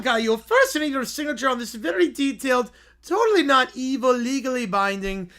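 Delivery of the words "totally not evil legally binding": usual speaking voice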